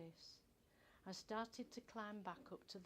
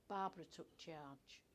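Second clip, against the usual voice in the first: usual speaking voice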